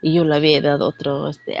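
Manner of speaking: normal talking voice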